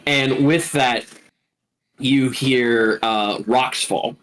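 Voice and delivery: ordinary talk, nothing marked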